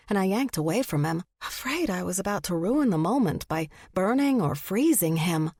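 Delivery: ordinary delivery, nothing marked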